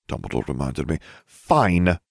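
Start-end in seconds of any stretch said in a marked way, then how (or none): none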